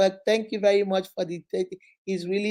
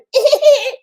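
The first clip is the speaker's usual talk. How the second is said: big burst of laughter, high and loud; 11 dB louder than usual. Real, not acted. Laughing at the suitor she just mimicked, loud and high. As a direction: break up laughing. Laughs at her own impression.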